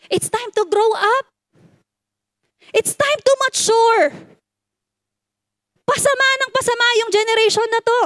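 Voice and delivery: impassioned sermon build; rising preaching. Acted. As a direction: push them to grow up. Preacher escalating the rebuke.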